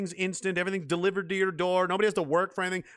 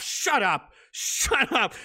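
exasperated rebuke; 7 semitones higher, much slower than usual. Real, not acted. Snapping back at the imagined victim.

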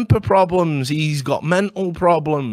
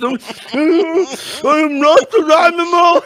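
high, wailing freak-show impression; hammed-up pitiful cry, high and loud; mock Elephant Man; 13 semitones higher, 5 dB louder, much slower than usual. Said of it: "Does the Elephant Man come out".

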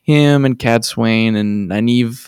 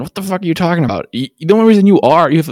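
mock outburst; 7 semitones higher, faster than usual. Rand snapping at Logain.

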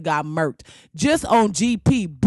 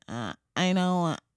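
sad whiny curl voice; 2 semitones lower, 6 dB quieter than usual. Retold or imitated. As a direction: voice the limp curl giving up. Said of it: "Whole head look like".

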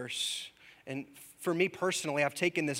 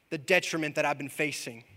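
normal talking voice

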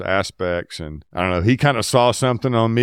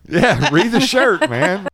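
slow, high, amused echo of the joke; laughing; 10 semitones higher, 5 dB louder, slower than usual. Real, not acted. Echoing friend's joke, laughing.

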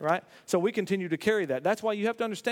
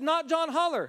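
high-pitched retelling by a rattled caller; agitated caller quoting; 8 semitones higher than usual. Retold or imitated. Psychologist reports the voice to the pastor, agitated. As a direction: repeat it urgently, pitched well up. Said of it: "The voice said".

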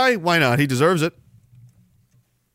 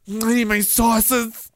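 slow, higher, put-on whine; mocking sauce lover; 5 semitones higher, much slower than usual. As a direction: beg for sauce like a spoiled child.

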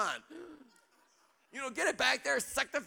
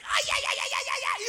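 shrill shout, much higher and louder; frantic yelling; 4 dB louder, faster than usual. Retold or imitated. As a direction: scream it with hand in fire. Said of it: "gonna be like".

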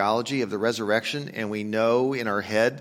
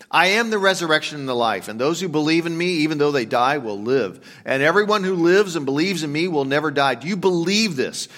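raised, ringing declaration of faith; preaching Jesus' words; 4 semitones higher, 6 dB louder than usual. Acted. Preacher voicing Jesus to Martha, proclaiming. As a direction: proclaim it like a promise to the grieving.

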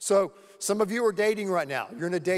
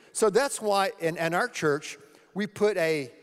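normal talking voice

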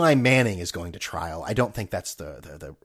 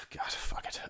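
muttered curse; 14 dB quieter than usual. Real, not acted. Muttering to himself.

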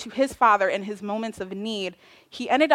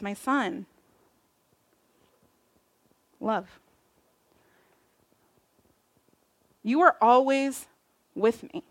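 tender father. Acted. Father tenderly answering older son.

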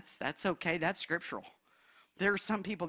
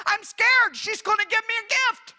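high, loud, squeaky panic in a put-on voice; hammed-up fearful squeal; mock scared escape; 11 semitones higher, 6 dB louder than usual. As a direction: play a frightened churchgoer bolting from a gift. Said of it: "would say".

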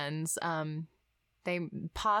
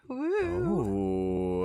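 deep woo; 11 semitones lower, 4 dB louder than usual. Acted. Playful cheer.

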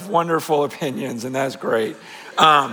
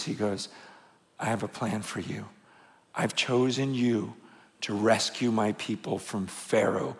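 much softer, low and intimate; hushed voice of God; 10 semitones lower, 8 dB quieter than usual. Acted. God speaking softly to Moses, hushed and intimate. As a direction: draw them in, almost murmuring.

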